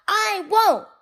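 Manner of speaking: high, loud "I won't!"; defiant shout. A narrator's reading. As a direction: shout the refusal like a naughty child. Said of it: "Pig Won't shouts out".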